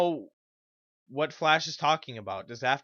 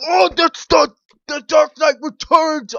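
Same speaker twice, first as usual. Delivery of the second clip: mock fanboy hype; 13 semitones higher, 12 dB louder than usual. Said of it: "people on Twitter be like".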